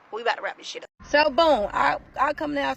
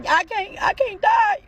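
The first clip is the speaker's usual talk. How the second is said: retold fear; 11 semitones higher, 6 dB louder, much slower than usual. Woman pleading with her man during standoff, imitated scared wail. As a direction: pitch climbing to a high, loud wail. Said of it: "And I'm scared".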